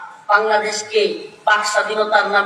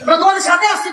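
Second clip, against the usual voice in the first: higher and louder ringing promise; loud reassurance; 6 semitones higher, 3 dB louder, faster than usual. Real, not acted. Preacher assuring the youth he stands with them, ringing out loud.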